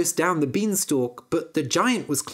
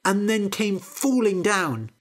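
plain reading voice

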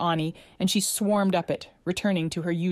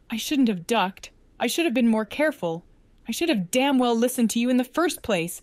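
higher, building, anguished self-reproach; choked up; 6 semitones higher, 3 dB louder than usual. A narrator's reading. Man blames himself over poisoned friend, choked up. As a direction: blame yourself, voice rising toward tears. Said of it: "His voice choked".

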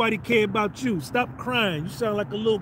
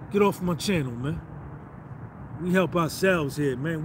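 ordinary talk

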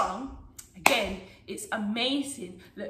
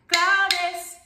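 higher, louder name call; 5 semitones higher, 5 dB louder than usual. Shown as a demonstration. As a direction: call it out for the children to echo. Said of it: "as you call the name claves".

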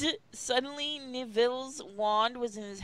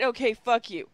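ordinary talk, nothing marked

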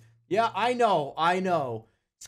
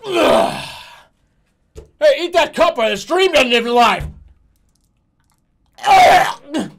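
disgusted; 4 semitones higher, 10 dB louder, slower than usual. Real, not acted. Disgusted at eating plastic.

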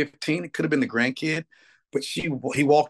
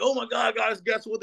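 much higher, mock-breathless excitement; retold excited rush; 10 semitones higher than usual. Back on the bus telling bandmates, excitedly high-pitched. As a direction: burst in like you can't hold it. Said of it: "I'm like".

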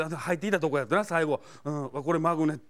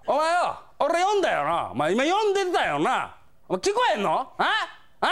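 yankee yelling; 9 semitones higher than usual. Retold or imitated. Delinquent yelling at a staffer at the used-book counter, furious. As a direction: bark it like an enraged delinquent. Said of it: "めっちゃキレてはるんです".

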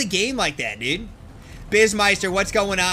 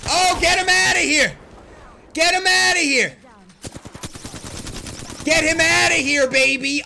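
loud, higher, gleeful get-him-out call; hyped shout; 5 semitones higher, 3 dB louder than usual. Real, not acted. Streamer hyped, yelling after knocking out an enemy. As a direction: crow over the kill at full volume.

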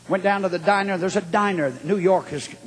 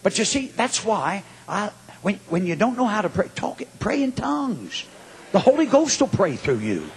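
normal talking voice

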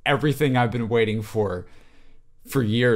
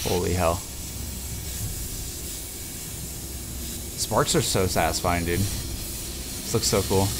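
hushed awe; 7 semitones lower, 7 dB quieter, slower than usual. Real, not acted. Awed by sparks.

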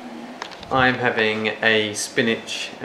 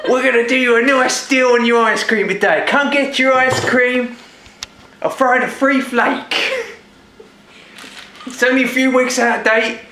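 higher, hammed-up East End London accent; a cheeky East End trader pushing ice cream; 9 semitones higher than usual. A market seller hawking old ice cream, mock East End London accent. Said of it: "the East End London's ice cream parlour".

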